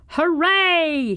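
high, loud cheering hooray; shouted cheer. A narrator's reading. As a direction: cheer for your team at full voice. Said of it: "he shouted".

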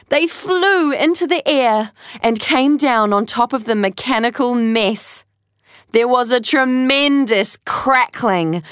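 ordinary delivery

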